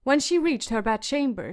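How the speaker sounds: ordinary delivery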